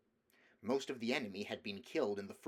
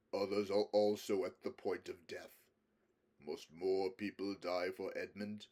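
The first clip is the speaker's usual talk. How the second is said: graver voice; 2 semitones lower than usual. Acted. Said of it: "said Aslan in a graver voice".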